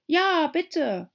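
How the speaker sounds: high, loud pleading chorus; all calling out together. A narrator's reading. The animals calling together, high and loud. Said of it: "alle anderen riefen im Chor".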